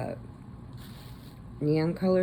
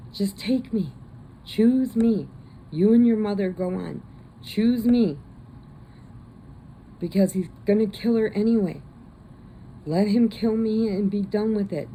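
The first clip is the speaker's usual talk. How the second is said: louder, insistent father begging; urgent pleading dad; 6 semitones higher, 5 dB louder than usual. Retold or imitated. Dad urging his daughter to choose him, loud and insistent. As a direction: push it like a father sacrificing himself.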